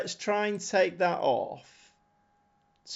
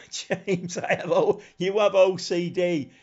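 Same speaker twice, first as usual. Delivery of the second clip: higher voice breaking out of a laugh; real amused laughter through the words; 4 semitones higher, 4 dB louder than usual. Real, not acted. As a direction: laugh as you tease, pitched up. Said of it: "I don't know why I'm laughing".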